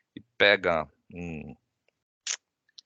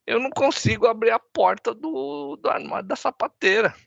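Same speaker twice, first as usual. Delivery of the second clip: put-on much higher, tearful child's voice; crying little girl; 13 semitones higher than usual. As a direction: play the small daughter close to tears. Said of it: "E aí a menina chorando, fala".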